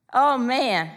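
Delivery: normal talking voice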